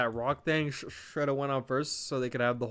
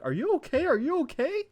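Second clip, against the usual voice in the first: much higher, squeaky are you okay; mock high concern; 14 semitones higher than usual. Vlogger mocking Roman checking on Rock, high-pitched. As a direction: ask it in a silly squeaky voice. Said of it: "looking at The Rock like".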